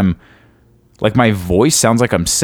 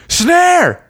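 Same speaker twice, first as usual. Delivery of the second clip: high yelled "Snare!"; mock yelled snare. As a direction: shout it from the booth. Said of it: "And they're like".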